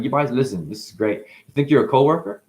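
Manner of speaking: ordinary delivery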